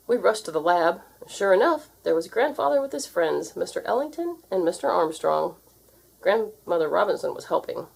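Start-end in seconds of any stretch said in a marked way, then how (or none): none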